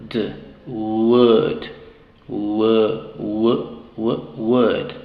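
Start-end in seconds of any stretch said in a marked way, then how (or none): none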